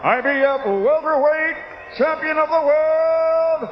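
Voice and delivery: rising to a high, loud, drawn-out roar; lucha libre announcer. Acted. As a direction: stretch the champion's name for the crowd. Champion introduction building to a roar.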